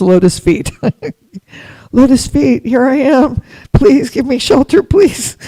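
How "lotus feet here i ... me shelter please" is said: high, loud, begging for shelter; pleading prayer. Retold or imitated. Disciple begging at her guru's feet, loud high plea. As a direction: cry out for shelter, higher and higher.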